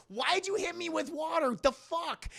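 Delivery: high-pitched acted complaint; indignant bafflement. Mother splashed with water, high indignant voice. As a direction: squawk in confused offence.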